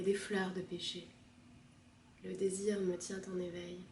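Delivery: soft, hushed lyrical recitation; poem reading. Acted. Reader describing moss and petals, soft poetic recitation. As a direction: recite gently, with long pauses between images.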